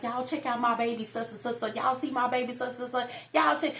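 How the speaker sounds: put-on high, excited bragging about my baby; proud bragging partner. Partner boasting to friends, excited and high. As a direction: show off your baby to everyone. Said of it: "They like".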